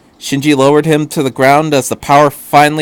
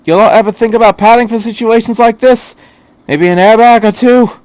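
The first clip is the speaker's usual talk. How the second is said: higher, louder teasing complaint; wry doctor; 9 semitones higher, 5 dB louder than usual. Acted. Doctor ribbing Shinji about missing padding, higher and louder. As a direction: needle the boy about the armor. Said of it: "he asked".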